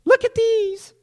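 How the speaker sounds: very high squeaky show-off voice; attention-seeker. Acted. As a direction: imitate someone begging to be looked at. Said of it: "Do you wear that to say".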